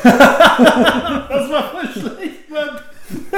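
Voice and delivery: laughing high while speaking; words squeezed through laughter. Real, not acted. Laughing at bad album.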